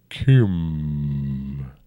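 put-on a rumbling old dragon. A narrator's reading. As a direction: roll the name out like a great dragon. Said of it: "rumbled the dragon".

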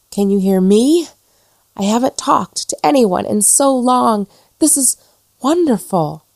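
high, delighted tumble of words; lonely ghost bursting with relief; overjoyed. Acted. Ghost to the first soul who hears her, delighted.

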